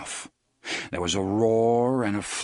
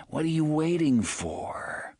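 persuasive lure; 6 semitones higher than usual. Acted. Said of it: "groaning, horribly persuasive voice".

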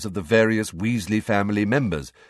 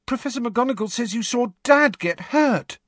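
put-on high, worried young girl; anxious, pressing question; frightened girl. A narrator's reading. Ginny frightened.

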